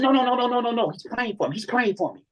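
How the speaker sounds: mock frantic stop; urgent stop. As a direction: stop the friend in a hurry. Gunman stopping his friend.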